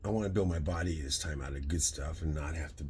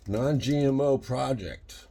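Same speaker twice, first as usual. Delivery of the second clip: slow, high, loud read of the label; emphatic label read; 6 semitones higher, 5 dB louder, much slower than usual. Real, not acted. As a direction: spell it out proudly.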